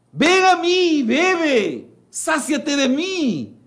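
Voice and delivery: preacher voicing the Lord's invitation; the Lord inviting. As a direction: plead it out, drawn long like an open-armed call. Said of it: "Entonces el Señor dice".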